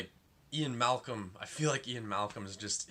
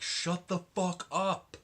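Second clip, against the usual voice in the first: higher, louder and slow, fed up; annoyed shout; 9 semitones higher, 4 dB louder than usual. Retold or imitated. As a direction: drag out the shut up like you've had enough. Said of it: "And I just was like".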